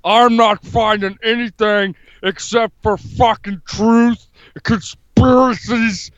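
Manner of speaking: hammed-up tough-guy politician impression; comic strongman bluster; Jesse Ventura. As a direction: declare it like a blustering off-grid candidate. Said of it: "Jesse, why do you live in Mexico".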